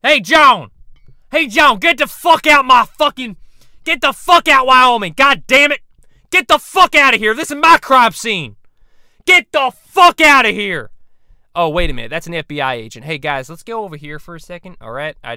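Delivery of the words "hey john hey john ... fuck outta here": furious shout. Acted. Dog yelling at John Walsh to leave, furious shouting. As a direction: yell him off the crime scene, high and loud.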